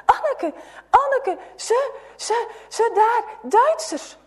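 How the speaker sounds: high, loud, breathless alarm; out of breath with alarming news; panicked boy. A narrator's reading. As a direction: blurt it out in a panic.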